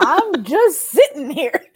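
high, slow and laughing through the words; laughing quote. Retold or imitated. Host mimicking Toph's bystander line, laughing. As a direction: giggle it out as the bystander.

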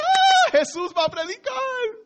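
high, laughing excitement in Spanish; excited crowd. Retold or imitated. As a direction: squeal with delight like the congregation. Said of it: "They're like".

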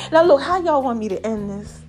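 usual speaking voice

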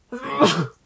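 loud sudden sneeze; a second real sneeze; sneezing. Real, not acted. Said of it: "I am about to sneeze".

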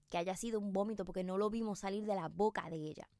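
ordinary delivery

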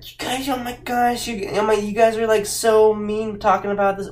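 high-pitched, put-on scandalised voice; mock offended commenter. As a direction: imitate a whiny, shocked online commenter. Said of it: "go in the comments and be like".

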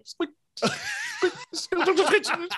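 high squeaky noise, laughing through it; high-pitched squeak squeak; imitated squeak. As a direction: mimic a squeaky bike in falsetto.

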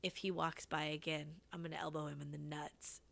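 soft muttered aside to attendant; conspiratorial hush. Retold or imitated. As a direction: keep it low and secret. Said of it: "I was like".

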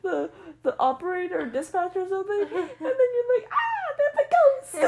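high laughing voice. Real, not acted. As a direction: laugh all the way through. Laughing hard while telling.

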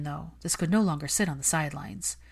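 ordinary delivery, nothing marked

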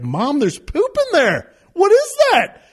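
high-pitched, rising to a loud squeal; shocked kid. Retold or imitated. Daughter finds the mess and asks mom, high shocked cry. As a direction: imitate a child's horrified discovery.

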